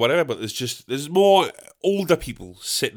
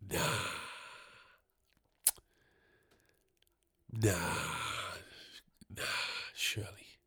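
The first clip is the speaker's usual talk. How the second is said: quiet disbelief; 7 semitones lower, 18 dB quieter than usual. Retold or imitated. Disbelief muttered to himself.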